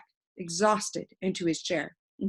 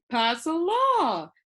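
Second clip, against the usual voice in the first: bright suggestion; 8 semitones higher, 4 dB louder, much slower than usual. A narrator's reading. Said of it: "the humbug suggested brightly".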